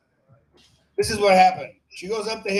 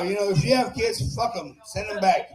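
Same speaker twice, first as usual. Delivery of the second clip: ordinary talk, nothing marked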